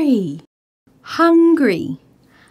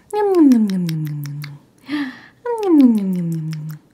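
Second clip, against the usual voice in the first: nom nom eating noises; 6 semitones lower than usual. Pretend. Feeding a doll its bottle.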